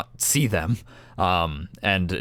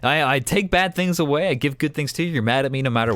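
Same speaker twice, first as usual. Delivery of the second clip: mock grievance; 7 semitones higher than usual. GM mock-defending himself.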